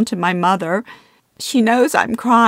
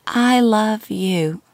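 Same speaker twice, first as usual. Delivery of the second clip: put-on slow, faint, dying old woman; frail old mother; 3 semitones higher, much slower than usual. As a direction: breathe it out weakly, very slowly.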